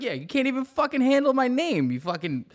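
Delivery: high, indignant retort to the guard; imitating his own outraged reply; mock defiant comeback.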